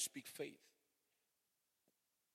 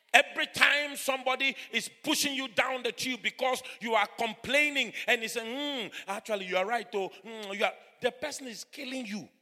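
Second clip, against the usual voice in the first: loud, raised preacher's exhortation; full-force preaching; 9 semitones higher, 15 dB louder than usual. Acted. Preacher urging listeners to speak faith, full-force preaching. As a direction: drive it home loudly from the pulpit.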